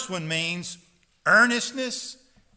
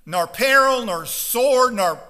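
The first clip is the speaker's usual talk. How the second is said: loud, fiery preaching to the congregation; full-force preaching; 4 semitones higher, 7 dB louder than usual. Acted. Pastor urging his congregation not to whine, fiery loud preaching. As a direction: pound the message home from the pulpit.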